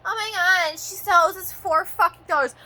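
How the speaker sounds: high, loud, panicky mock voice; mocking the company staff. Mocking company staff who forgot to call, high panic. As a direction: squeal it like a flustered clerk. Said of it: "they're like".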